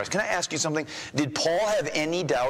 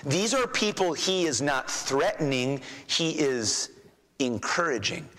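ordinary talk, nothing marked

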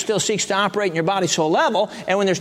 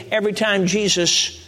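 normal talking voice

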